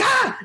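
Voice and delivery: sudden high startled "Ah!"; startled shriek. Retold or imitated. Character in a horror movie, startled yelp. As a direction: jump back with a short high yelp. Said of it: "the person's like".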